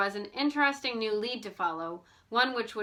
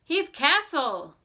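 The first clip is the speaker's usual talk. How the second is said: louder, slow, higher astonishment; recognizing the castle in disbelief; amazed exclamation; 5 semitones higher, 6 dB louder, much slower than usual. A narrator's reading. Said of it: "exclaimed George".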